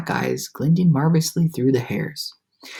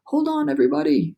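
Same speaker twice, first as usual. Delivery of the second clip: much higher, urgent call; 11 semitones higher than usual. A narrator's reading. James warning friends before impact, urgent call. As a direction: call them to brace. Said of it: "James called out".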